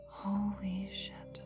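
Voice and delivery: slow, soft, drawn-out amazement; stunned hush. Acted. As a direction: let it out slowly under the breath. Stunned by what was revealed.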